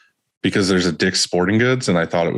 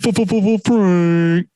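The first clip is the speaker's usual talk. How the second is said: very high, loud, nasal stutter; stuttered nasal F sounds, pitched way up; 12 semitones higher, 4 dB louder than usual. Real, not acted. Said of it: "it was perfect 'cause it was so nasal".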